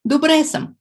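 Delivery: "Добре съм" said loud and high, cleanly; clear teaching demo. Shown as a demonstration. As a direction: model "Добре съм" for students to copy. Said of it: "It means I'm fine".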